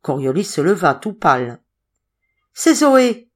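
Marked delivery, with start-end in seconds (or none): 2.5-3.3 high, loud startled exclamation; agitated cry. A narrator's reading. Madeleine announces that Zoé is there, agitated cry. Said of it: "cria Madeleine dans une grande agitation".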